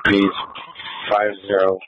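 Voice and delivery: usual speaking voice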